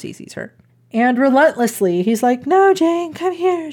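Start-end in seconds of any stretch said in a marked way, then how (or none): 0.0-0.5 breathy whispered aside, all air. Acted. Retelling Jane Eyre to a cohost, whispered aside. Mock-ominous aside about Rochester. 2.5-3.7 mock Rochester. Voicing Rochester calling Jane.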